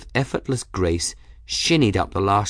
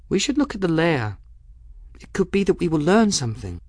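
put-on quick, higher-pitched girl voice; determined girl; 9 semitones higher, faster than usual. Bridget proposing to search the lair, determined. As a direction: urge it briskly, pitched up.

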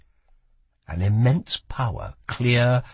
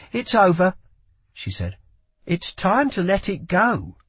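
pleading; 9 semitones higher than usual. A narrator's reading. Lila pleading with Kel.

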